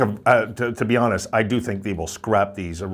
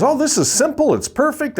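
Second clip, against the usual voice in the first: much higher, mocking the online poster's voice; mock online fan; 3 dB louder than usual. Mocking someone online who loved the logo, much higher smug voice. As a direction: parody a smug fan who thinks it's simple. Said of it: "somebody online says".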